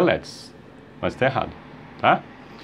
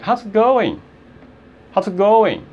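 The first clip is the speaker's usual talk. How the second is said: quick, contracted, higher pitch; fast demo; 7 semitones higher, slower than usual. Shown as a demonstration. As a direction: say it in one brisk, higher sweep. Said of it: "quando você fala rápido, aí sai". (rápido, emendando as palavras)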